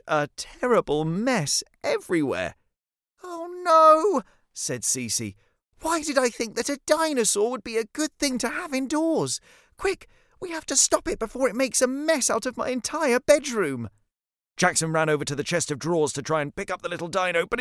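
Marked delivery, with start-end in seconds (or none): 3.2-4.2 put-on high, loud girl's "oh no"; high-pitched cry of dismay, drawn loud; dismayed child. Cece watching the dinosaur wreck her room, dismayed. 5.8-13.9 put-on high-pitched, urgent child's voice; dismayed young girl. Cece panicking as the dinosaur wrecks her bedroom, dismayed.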